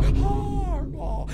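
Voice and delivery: high, drawn-out mock-adoring sigh; angelic. Showing how fans talk about their coach, mock angelic. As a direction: sing out the name like a worshipper. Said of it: "in such an angelic way".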